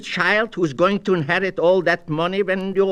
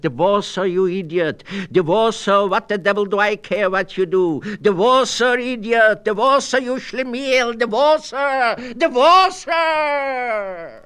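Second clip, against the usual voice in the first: furious yell that climbs higher and louder, the last divorce her drawn out slow; losing it completely, bellowing divorce her over and over; shouting; 6 semitones higher, 4 dB louder than usual. Retold or imitated. Shaking a dithering friend by the lapels, furious building shout. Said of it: "shook him and shouted".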